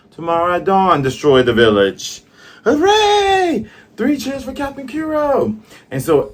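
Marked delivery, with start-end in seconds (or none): 0.1-2.2 louder, higher order to the crew; rallying command. Acted. Kuro commanding his crew. 2.6-5.6 very high, loud hooray; crew cheering. Acted. Crew cheering for their captain.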